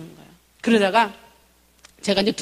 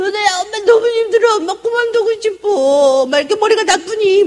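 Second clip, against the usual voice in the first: retold crying; 9 semitones higher, 6 dB louder than usual. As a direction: wail that you want to quit. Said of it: "울면서 막 기도를 했어요".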